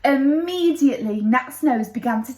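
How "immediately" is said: ordinary delivery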